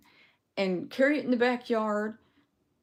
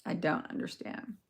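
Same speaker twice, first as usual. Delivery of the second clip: whispered; 3 semitones lower, 7 dB quieter than usual. Real, not acted.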